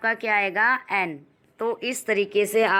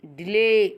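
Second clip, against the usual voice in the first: "Delay" with a long, stretched "ले"; deliberate teacher's model of "delay"; syllable stress demo; slower than usual. Shown as a demonstration. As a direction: lean on the end of "delay" and hold it. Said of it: "दबाव दे के बोलते हैं".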